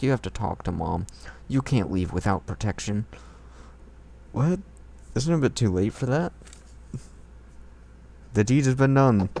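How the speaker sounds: ordinary talk, nothing marked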